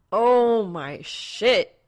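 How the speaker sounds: slow, loud drawn-out whine; exaggerated loud whine; whining. A narrator's reading. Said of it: "Blake whines".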